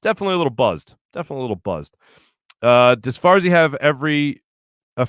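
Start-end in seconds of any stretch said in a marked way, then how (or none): 1.1-2.0 dropped low and soft; quiet. Real, not acted. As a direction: say it quietly, as an aside.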